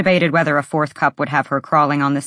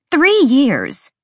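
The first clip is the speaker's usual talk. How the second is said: high, loud outraged cry; sharp exclamation; 10 semitones higher, 5 dB louder than usual. Acted. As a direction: snap it out in disbelief. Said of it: "Ember's voice sharpened".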